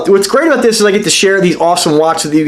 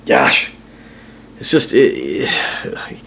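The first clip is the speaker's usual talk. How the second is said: low, halting, soft frustration; frustrated; 8 semitones lower, 4 dB quieter, much slower than usual. Real, not acted. Watch reviewer torn over his favorites, frustrated. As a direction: trail off slowly, lost for words. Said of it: "you hear the frustration in my voice".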